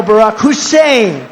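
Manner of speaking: slow mock holler, loud and high; imitating screamed name. Speaker imitating a radio host screaming the name, loud. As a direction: bellow "Hussein" like a ranting radio host. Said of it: "he'd be screaming".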